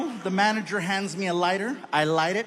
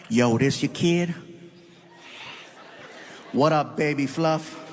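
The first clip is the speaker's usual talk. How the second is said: lower, cool laid-back [name] voice; [name] impression; 6 semitones lower than usual. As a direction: play it cool and low like [name]. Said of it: "[name] is like".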